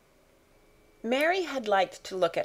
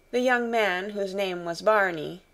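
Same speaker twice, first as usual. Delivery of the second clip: plain reading voice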